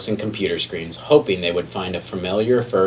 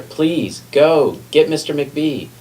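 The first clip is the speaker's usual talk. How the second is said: slow, higher, pleading request; urgent plea; 7 semitones higher, slower than usual. A narrator's reading. Hurt librarian begging the lion to fetch help, slow and higher. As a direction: ask the lion slowly and earnestly for help. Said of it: "said Miss Merriweather".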